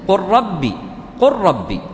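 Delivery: قل ربي said as "qurrabbi", stressed doubled rā. Shown as a demonstration. Teacher demonstrating the rule just given, قل ربي merged into one word with doubled R. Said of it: "وينطق مشدد".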